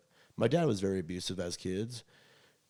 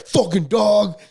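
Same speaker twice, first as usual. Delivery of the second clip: growled dad impression, much higher and louder; angry dad; 12 semitones higher, 16 dB louder, much slower than usual. Imitating his dad cursing the dog, mock angry. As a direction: bark it like his furious dad.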